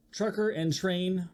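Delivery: usual speaking voice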